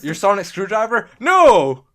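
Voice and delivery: frantic, loud, high cries; panicked shout. Acted. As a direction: yell it in a panic. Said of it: "she'll be going, like".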